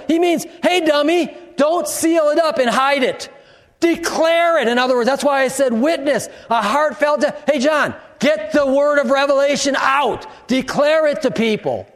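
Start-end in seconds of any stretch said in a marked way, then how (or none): 0.6-4.7 mock scolding shout. God telling the reader not to hide it, shouting. Voicing God's rebuke, preached. 7.5-11.9 commanding shout. Acted. Voicing the command to John.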